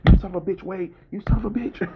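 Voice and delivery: high, strained cry of the curse; anguished Wolverine. Retold or imitated. Wolverine breaking the door for Wade, high anguished cry. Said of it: "Wolverine's like".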